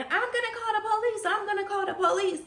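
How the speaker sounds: hurried, high-voiced imitation of a yelled threat; imitated yelling. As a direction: mimic a neighbor calling out a window. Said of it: "someone is yelling out the apartment window".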